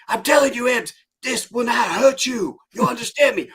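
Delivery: high, loud drill instructor imitation; mock sergeant shout. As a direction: drive it into the recruit's head, shouting. Acted sergeant shouting at Ebbs.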